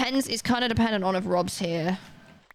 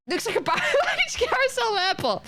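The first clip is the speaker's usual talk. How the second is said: laughing; 14 semitones higher than usual. Real, not acted. Mocking the new ride design.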